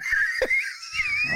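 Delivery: shrieking high burst of laughter; squealing laugh over the kicked chicken. Real, not acted. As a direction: cackle helplessly at his own story.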